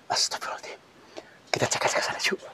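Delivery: hushed whispered prayer; fearful whisper. Real, not acted. Ghost hunter whispers to crew to check there, scared whisper.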